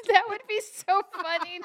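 high, loud, breaking into laughter; laughing. Real, not acted. Delighted by the paper bag idea, laughing. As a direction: exclaim it while cracking up.

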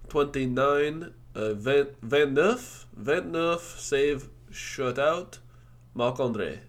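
mock French numbers. Shown as a demonstration. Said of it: "You gotta stop with the French".

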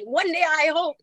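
high, airy mock-hopeful sigh; lightly mocking a someday wish; mock wistful dreamer. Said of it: "where I'm like".

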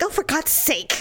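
fed-up sigh of a mother; exasperated mom. Retold or imitated. Mother losing patience at the fitting room, mildly exasperated. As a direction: huff it like an impatient mom. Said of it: "it's like".

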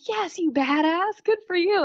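high, slow, delighted praise; cheering her on. Retold or imitated. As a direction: cheer her on like a proud fan. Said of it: "But now I'm thinking".

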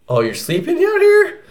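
high, surprised dog voice; friendly dog voice. Retold or imitated. Pit bull welcoming owner to couch, high surprised voice. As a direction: voice the pit bull making room.

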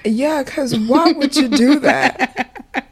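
laughing. Real, not acted. Laughing at the smile idea.

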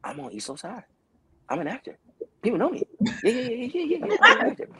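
telling himself he's an actor, mock swagger; cocky inner voice. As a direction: swell with pride. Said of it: "I'm going".

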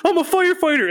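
high, loud, giddy yell; cheering about being a firefighter; excited Hank. Retold or imitated. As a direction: burst out thrilled like a proud kid. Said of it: "He's like, he's like".

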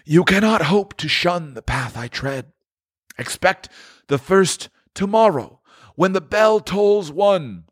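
high, solemn ghostly lament; a tormented spirit grieving over his wasted life; mournful ghost, pitched high; Marley's ghost. Acted.

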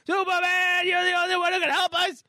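high-pitched desperate cry for help; Mitch. Acted. Boy begs Superman to save trapped ma, high frantic cry. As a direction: squeal it out like a panicked kid. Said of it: "She's crying out to Mitch, and Mitch, she's".

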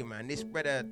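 ordinary delivery

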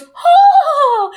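high startled scream with laughter; scared noise. Retold or imitated. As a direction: shriek like something frightened you. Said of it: "she just makes a scared noise".

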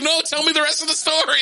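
put-on very high child's demand; pleading young daughter. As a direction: beg like an eager little kid. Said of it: "she's like".

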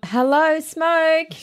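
high, dim-witted hello; dopey mock greeting. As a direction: play it clueless and chirpy. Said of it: "they were kind of like".

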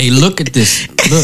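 laughing through a sly mock come-on; mock creep voice. Cohost laughing while voicing the flasher's come-on. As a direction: tease it out like a creep with a giggle.

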